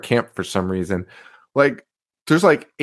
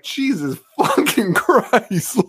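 slow, drawn-out, higher exclamation through a laugh; stunned disbelief; 8 semitones higher, much slower than usual. Retold or imitated. Hearing a little kid swear, slow amazed exclamation. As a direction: stretch it out in amazement.

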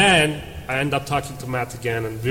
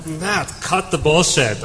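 pissed off; 3 semitones higher, slower than usual. Retold or imitated. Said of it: "I get really pissed off".

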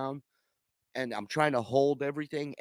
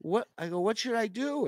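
panicked disbelief; 10 semitones higher than usual. Retold or imitated. Panicked traveler asks agent.

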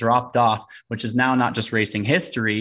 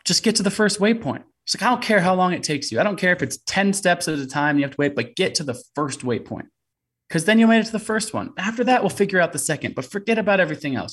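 imitating his wife, much higher and louder, pushing hard; mock the wife's insistent urging, pitched way up; wife's urgent pep talk; 9 semitones higher, 3 dB louder than usual. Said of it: "And she goes".

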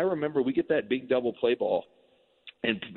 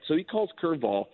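ordinary talk

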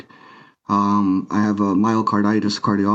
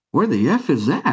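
higher-pitched, mock-incredulous question; retold bewilderment; 6 semitones higher than usual. Patient retelling his question to hospital staff, bewildered. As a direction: raise the pitch as if baffled by the news.

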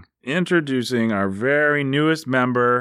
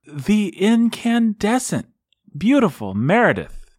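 grand announcer; 7 semitones higher than usual. Acted. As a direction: savour every word of the name. Hyping up each team name.

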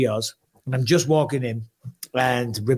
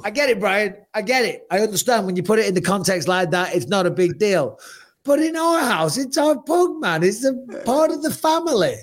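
high, pleading voice rising higher; mock pleading; 6 semitones higher than usual. Pleading with his friend Brian about the dead pug, high and whiny. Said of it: "I'm like".

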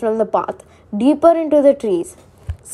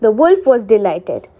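usual speaking voice

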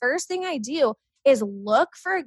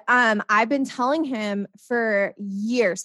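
ordinary talk

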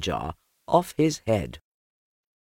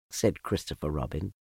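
ordinary delivery, nothing marked

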